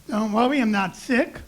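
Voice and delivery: ordinary delivery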